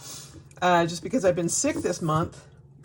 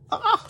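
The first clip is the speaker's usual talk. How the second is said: delighted squeal; 3 dB louder than usual. Real, not acted. Squeals at a sticker sheet.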